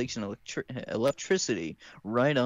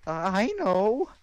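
Ruby voice; 9 semitones higher, 3 dB louder than usual. Acted. Ruby line.

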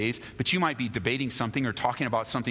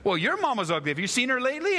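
mock schoolyard comeback; 13 semitones higher, 3 dB louder than usual. Kid hits back with insult.

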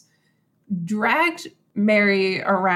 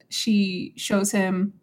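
ordinary delivery

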